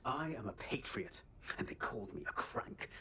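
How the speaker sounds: ordinary delivery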